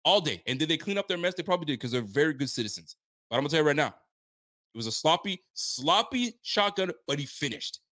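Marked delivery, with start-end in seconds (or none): 3.9-6.9 drawn out. Real, not acted. Teasing about messy shotgun.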